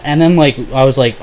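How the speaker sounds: ordinary delivery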